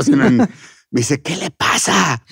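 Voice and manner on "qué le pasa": slow, higher mock indignation; out-of-character scolding. Kids' host dropping character to scold the puppeteer, slow and indignant. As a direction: draw out an offended reprimand. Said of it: "con esta voz así de, no, ya no en Chabelo".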